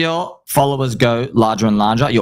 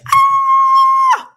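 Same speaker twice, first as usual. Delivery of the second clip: sudden shrill-pitched shriek, much louder; high mock scream; 5 dB louder than usual. Mocking Andrew Tate's Matrix rant with a high scream. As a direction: throw out a hammed-up high scream.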